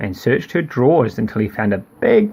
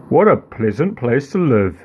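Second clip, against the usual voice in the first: soft voice. Acted. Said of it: "said the soft voice".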